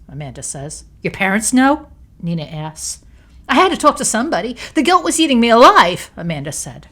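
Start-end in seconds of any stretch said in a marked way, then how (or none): 1.0-1.9 sharp, louder startled question; raised and higher in shock; surprised. A narrator's reading. Nina shocked. 3.5-6.1 high, loud and fast outburst; higher, louder and fast, near tears; distraught. A narrator's reading. Amanda defending herself.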